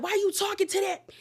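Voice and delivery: high, fast accusing voice; wife accusing husband behind closed door, high fast anger; mock angry rant. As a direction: fire the questions at him in a high rage. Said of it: "she was going off".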